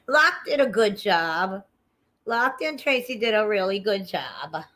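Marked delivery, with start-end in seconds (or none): none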